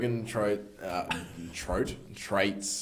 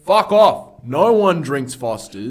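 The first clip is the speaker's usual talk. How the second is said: louder, higher yelled outburst; shout; 3 semitones higher, 13 dB louder, slower than usual. Real, not acted. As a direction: yell it in mock outrage. Heated rant about Fosters.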